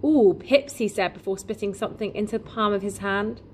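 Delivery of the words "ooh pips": sudden high yelp at pips; surprised. Acted. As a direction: caught off guard mid-chew. Raj finds pips chewing.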